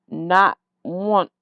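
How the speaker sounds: usual speaking voice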